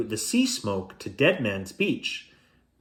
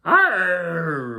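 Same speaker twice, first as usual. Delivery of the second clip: loud pirate arr; pirate bellow; 6 semitones higher, 4 dB louder than usual. Acted. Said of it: "bellowed the terrible".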